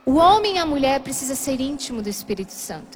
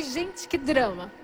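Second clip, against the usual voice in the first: softer, slow, dismissive aside; wry aside; 3 semitones lower, 5 dB quieter, much slower than usual. Retold or imitated. Woman judging her own outburst, wry aside. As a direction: step out of the outburst, slow and quiet. Aside to herself amid quarrel.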